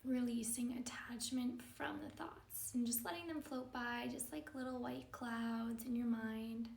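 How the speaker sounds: calm, measured yoga-teacher meditation delivery; guided meditation. Acted. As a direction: guide them gently into stillness, leaving space between phrases.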